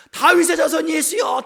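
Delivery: high, loud, drawn-out shout. Acted. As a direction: cry out over the scolding crowd. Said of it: "더 크게 소리를 지르는 거죠". (더 크게, 높고 길게 외치며)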